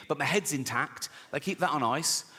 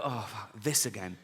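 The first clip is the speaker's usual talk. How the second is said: weary; 8 semitones lower, 5 dB quieter than usual. Acted. Acting weariness of getting older.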